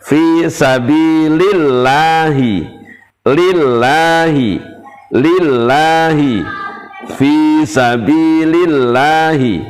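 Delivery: lillahi said light and thin. Shown as a demonstration. Teacher chants a thin lillahi for class. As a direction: keep lah thin after the i in sabilillahi. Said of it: "Contoh misalnya".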